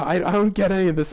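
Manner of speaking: can't make sense of it at all; baffled. Real, not acted. Host baffled by the fanfic story, exasperated.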